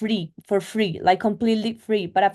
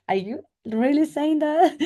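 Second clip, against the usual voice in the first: higher-pitched, disbelieving retort; mock incredulous question; 7 semitones higher than usual. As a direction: question him as if you can't believe it. Offended retort to date's remark.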